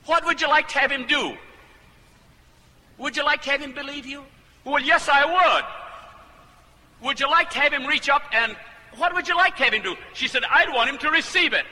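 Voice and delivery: high, loud pressing questions; insistent preaching. Acted. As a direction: drive the question home like a preacher.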